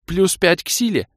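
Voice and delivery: very high loud delight; excited squeal. Acted. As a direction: crow it with glee. Sorceress thrilled. (восторженный возглас)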